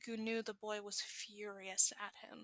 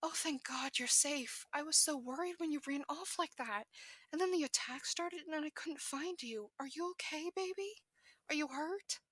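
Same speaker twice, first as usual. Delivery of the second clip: worried mother; 5 semitones higher than usual. Acted. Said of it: "The woman shouts".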